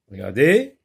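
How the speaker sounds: ordinary talk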